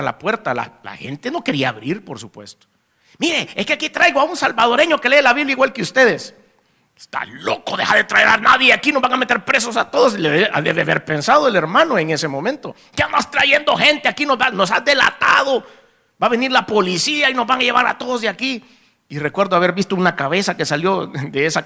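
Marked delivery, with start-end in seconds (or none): 3.2-6.3 acted high-pitched plea at a closed door; urgent appeal. Guide pleads at a closed door. 7.0-10.2 acted panicky reproach, rising high and loud; alarmed scolding. Imagined frightened host scolds the guide. 12.9-18.6 high-pitched acted reproach; alarmed scolding. Imagined frightened host scolds the guide.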